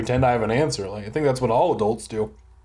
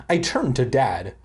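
normal talking voice

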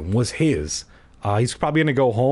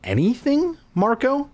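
sister voiced; 13 semitones higher than usual.

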